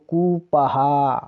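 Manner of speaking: कुपहा with a long oo and drawn-out ending; teacher sounding out कुपहा as koo-pa-haa; pronunciation demo. Shown as a demonstration. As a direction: stretch first and last vowels of कुपहा. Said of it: "बड़े ऊ की मात्रा का उच्चारण".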